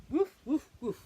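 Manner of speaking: loud playful woof woof; barking dog. Pretend. Reading to a child, dog barks loudly. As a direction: bark it out like a happy dog. Dog noise in bedtime book.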